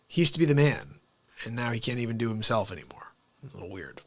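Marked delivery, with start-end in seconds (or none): 1.4-4.0 whispered aside. Real, not acted.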